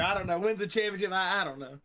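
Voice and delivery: loud, stunned mock echo; dazed champion voice. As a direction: parrot the winner, dumbfounded. Host echoes dazed champion.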